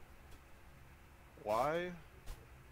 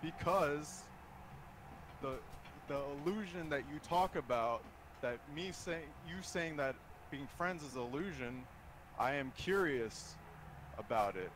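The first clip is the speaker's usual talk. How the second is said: nervous breakdown; 4 semitones higher, 9 dB louder than usual. Real, not acted. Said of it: "This is me talking right now".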